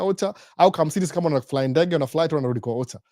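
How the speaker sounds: ordinary talk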